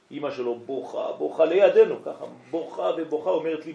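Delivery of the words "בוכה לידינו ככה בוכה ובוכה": emphatic telling. Real, not acted. Mother cries beside them.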